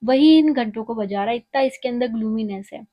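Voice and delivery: usual speaking voice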